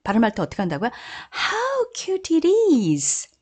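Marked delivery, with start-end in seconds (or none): 1.3-3.3 much higher, very slow cooing "How cute it is."; gushing exclamation. Shown as a demonstration. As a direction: gush over something adorable. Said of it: "감탄하는 말이니까요". (아주 높게 감탄하며 귀엽다는 듯)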